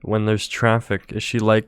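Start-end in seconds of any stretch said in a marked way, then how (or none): none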